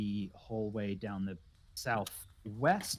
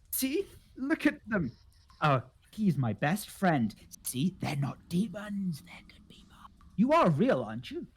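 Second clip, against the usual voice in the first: higher, gasping wonder, dropping to a whisper; excitable recluse; 5 semitones higher than usual. Acted. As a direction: eccentric host amazed by company.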